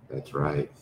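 low, soft, sinister taunt; menacing Gareth. Acted. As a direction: murmur it down low like a villain twisting the knife.

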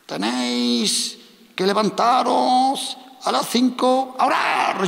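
put-on high, slow, trembling old woman's voice; old prophetess. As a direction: imitate a shaky elderly prophetess preaching. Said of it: "temblaba así".

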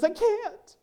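high, small kindergartner's plea; little girl voice. Retold or imitated. Sally answers the teacher from the floor, high and small.

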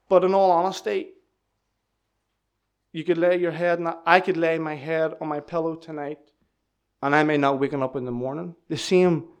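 ordinary talk, nothing marked

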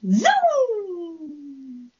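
long, high zoom gliding down; stretched-out zooming sound, falling away; onomatopoeic zoom. Shown as a demonstration. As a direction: imitate something zooming off into the distance. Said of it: "I'm going to use onomatopoeia".

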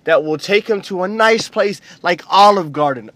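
normal talking voice

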